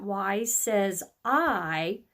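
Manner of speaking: Y says I, drawn out slowly; slow explanation. Shown as a demonstration. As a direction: spell out the rule slowly for kids. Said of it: "sometimes that".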